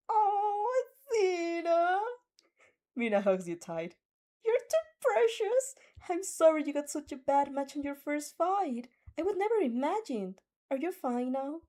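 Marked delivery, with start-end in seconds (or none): 0.1-2.0 high, drawn-out teary squeal; emotional high-pitched cry of joy; gushing cry. Acted. Mina overjoyed hugging Athena. 4.4-11.6 gushing. Acted. Mina fussing over Athena.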